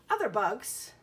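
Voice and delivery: ordinary talk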